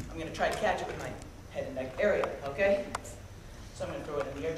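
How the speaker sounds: ordinary delivery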